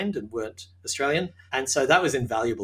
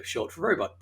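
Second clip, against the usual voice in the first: usual speaking voice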